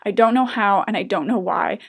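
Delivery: ordinary talk, nothing marked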